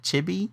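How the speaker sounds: Chibi as CHIH-bee, rising question; questioned pronunciation. Shown as a demonstration. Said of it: "how do you pronounce it".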